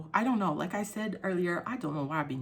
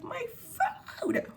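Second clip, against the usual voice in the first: possessive mock inner voice. Possessive over food, inner voice.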